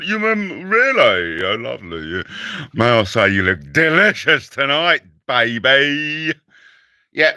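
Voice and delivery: low, oily chat-up voice; sleazy flirt. Pretend. Comic playing a sleazy flirt to a woman, low. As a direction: charm her lecherously.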